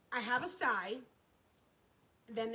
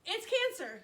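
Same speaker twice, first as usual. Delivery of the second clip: higher, stronger repeat of the result; dramatic higher-pitched announcement; alarmed repeat; 5 semitones higher than usual. Retold or imitated. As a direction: lift the pitch and push it out in alarm.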